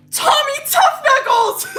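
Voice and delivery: high, laughing mock yell; loud, high, derisive whoop; mocking shout. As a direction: holler a taunting nickname, stretched out. Mocking his reckless boast.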